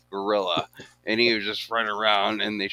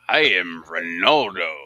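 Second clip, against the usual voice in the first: lower, mock-grand announcing voice; grand self-introduction; 4 semitones lower, 4 dB louder than usual. Imitating the man with the enviable name, low grand voice. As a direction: announce the name like it's a title. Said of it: "He's like".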